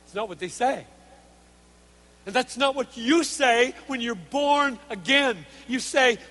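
loud, measured sermon delivery; emphatic preaching. Real, not acted. Preacher laying out God's design in loving us, emphatic preaching. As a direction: drive the point home word by word. Preaches how converts speak.

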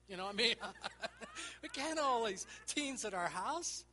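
high, loud, laughing warmth; jovial, beaming pep talk; laughing reassurance. Retold or imitated. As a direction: reassure the boy through laughter. Preacher retells his fond laughing reply.

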